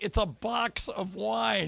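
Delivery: slow disdain; mocking disbelief. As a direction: drag it out with scorn. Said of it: "we're like".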